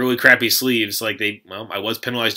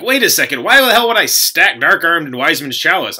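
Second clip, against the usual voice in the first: higher, louder incredulous protest; indignant retort; 6 semitones higher, 5 dB louder than usual. Retold or imitated. Player challenging the judges' stacking claim, retold indignation. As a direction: act out an exasperated objection.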